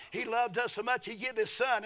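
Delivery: ordinary talk, nothing marked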